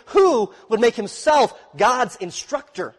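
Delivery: incredulous. Shown as a demonstration. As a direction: ask it as if the idea were absurd. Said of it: "You see the incredulity of that statement?".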